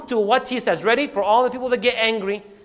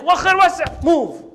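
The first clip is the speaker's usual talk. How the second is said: angry; 7 semitones higher than usual. Acted. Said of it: "gets so angry".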